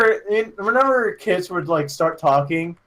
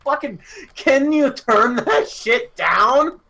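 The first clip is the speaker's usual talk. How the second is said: higher, slow, drawn-out complaint; retold exasperated plea; 6 semitones higher, slower than usual. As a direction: plead to get the noise turned down. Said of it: "I would just be like".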